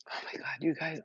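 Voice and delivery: breathless whisper, overcome with feeling; emotional whisper. Real, not acted. To her viewers, an emotional whisper. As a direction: whisper it, moved almost to tears. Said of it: "I'm getting so emotional".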